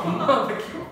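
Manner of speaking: real laugh breaking through the words; bursting into laughter. Real, not acted. Laughing at the absurd message.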